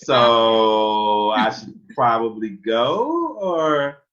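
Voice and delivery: loud, high, drawn-out hesitation; hammed-up uncomfortable stalling; awkward realisation. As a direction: stretch it out slowly, edging toward the exit. Acts out awkward realisation with mom.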